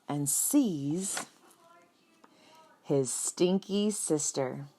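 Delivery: ordinary delivery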